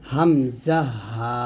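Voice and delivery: হামজা হা drawn out slowly from the bottom of the throat; slow demonstration of হামজা হা, each letter separate; throat-start articulation. Shown as a demonstration. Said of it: "এই শুরু থেকে আমরা হামজা উচ্চারণ করব".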